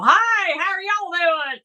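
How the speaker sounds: high country toy-bear voice; creepy talking bear. Retold or imitated. Host imitates the creepy Billy Bob Bear toy greeting, high country voice. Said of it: "it had a country accent like us".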